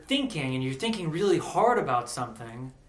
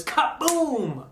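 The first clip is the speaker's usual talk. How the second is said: a high, loud burst of "kaboom"; sudden kaboom; 9 semitones higher, 5 dB louder than usual. Retold or imitated. Narrator bursting out as the police officer appears. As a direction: pop it out like an explosion.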